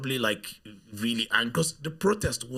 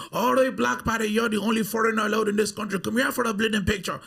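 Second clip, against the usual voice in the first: higher-pitched, lively mock local; imitating Irish guy; 9 semitones higher, 4 dB louder than usual. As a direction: play a cheeky passer-by calling him over. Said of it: "I remember this guy said something".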